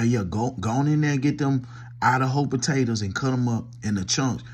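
ordinary talk

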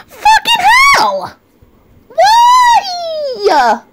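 very high, drawn-out cry of disbelief; exasperated wail. Real, not acted. Streamer reacting to gifted subs, exasperated high wail. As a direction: wail it at the top of your range.

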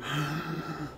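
audible gasp of air through the mouth; demonstrated mouth breath. Shown as a demonstration. Shaykh showing meditators the wrong breathing, mouth gasp. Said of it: "breathe with the mouth".

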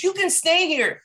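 high mock female invitation; woman's voice imitation. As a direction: flip up high to imitate her. Quotes the woman inviting them.

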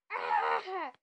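sharp low cry of frustration; pained shout. Real, not acted. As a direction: blurt a loud startled cry. Sudden death in game.

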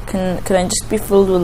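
usual speaking voice

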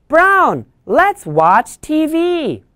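put-on high, slow little-girl call; calling the dog. Jenny asking Brown to watch TV, high and slow. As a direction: invite the puppy sweetly.

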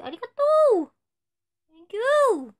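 loud, high "Thank you！" flung out with a jump in pitch; shouted thanks. Acted. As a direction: belt "Thank you！" like an idol on stage. Idol thanking fans, shouted catchphrase.

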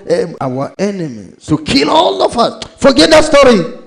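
high, loud preacher in full flow; fiery preaching. Acted. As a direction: preach it out, rising to a shout.

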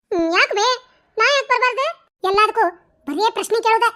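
normal talking voice

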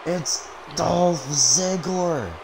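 high, drawn-out wrestling announcer call; excited announcer reveal. Acted. Commentator revealing the Bar's chosen partner, excited announcer. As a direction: stretch the name out in a big reveal.

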